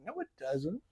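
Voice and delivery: very soft imitated protest; small, quiet mock objection to a teasing friend; barely voiced pushback; sheepish denial. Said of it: "And I'm like".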